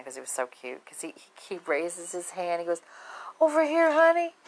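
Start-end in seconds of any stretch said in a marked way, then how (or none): 3.4-4.3 put-on high, loud old man calling over; put-on high, elderly voice. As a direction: imitate a sweet old man, pitched way up.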